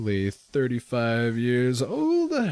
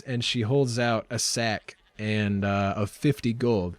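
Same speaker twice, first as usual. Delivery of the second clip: usual speaking voice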